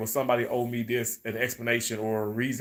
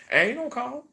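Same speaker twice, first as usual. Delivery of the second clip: sassy refusal; 9 semitones higher, 4 dB louder than usual. Retold or imitated. Defiant reply to callers, laughing.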